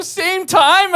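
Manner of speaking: Same time? shot way up high and loud; shocked friends. Retold or imitated. Friends repeating the detail in shocked disbelief, high and loud. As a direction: squeal it in disbelief. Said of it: "They go".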